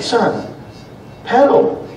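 sharp, high, urgent order to pedal; firm command. Retold or imitated. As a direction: snap at the boy to pedal. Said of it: "I said".

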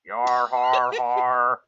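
loud pirate yar-har; pirate robot voice. Retold or imitated. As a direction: belt a cartoon pirate laugh. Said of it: "generic pirate phrases in a robot voice".

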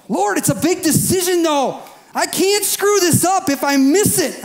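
high, anxious pleading to the Lord; mock panic. Preacher voicing a panicked believer to the congregation, high and fretful. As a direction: act out a worried believer fretting over a big decision.

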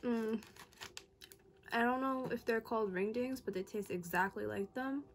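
licking fingers between words; talking while chewing. Real, not acted. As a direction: mouth partly full of cake. Seen 0: Bites cake at -5, hand to mouth, chewing while speaking until ~7s.

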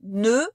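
closed, held vowel in nœud. Shown as a demonstration. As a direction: pronounce nœud carefully on its own. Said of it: "nous allons les prononcer".

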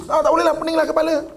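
high, fast, acted exasperation; knee-jerk refusal. Person refusing before thinking, high exasperated blurt. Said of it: "Dia ah dulu, dia tak boleh dulu".

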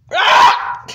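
sharp startled shriek; a short piercing scream of fright; high shriek. Acted. Toy character screams.

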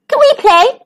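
high squeaky plea; high-pitched small-animal voice; squeak. A narrator's reading. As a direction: ask like an eager little mouse. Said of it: "they squeaked".

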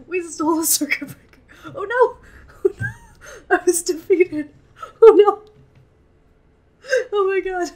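loud, laughing through tears; overwhelmed, half laughing, half sobbing; emotional laugh-crying. Real, not acted. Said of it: "I am too emotional for this right now".

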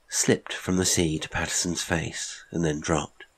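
ordinary delivery, nothing marked

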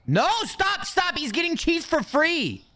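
high panicked pleading; panicking gamer. Real, not acted. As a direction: yelp it as things go wrong. Panics as customer takes cheese.

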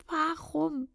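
rising anguish. Acted. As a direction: let the pain grow. Verzweifelte Frage, dreimal wiederholt. (höher, klagend)

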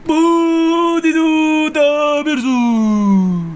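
high, loud, slow drawn-out shout; loud, slow, high mock shout of a celebrity. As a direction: stretch the words out in a loud high cry. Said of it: "[name]さんのモノマネね".

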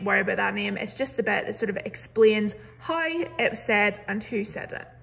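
ordinary delivery

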